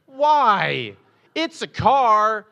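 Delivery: high, loud, slow frustrated cry; exasperated outburst. Retold or imitated. Pastor retelling his frustration at his grandmother, exasperated shout. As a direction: burst out in disbelief at grandma. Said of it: "And I was like".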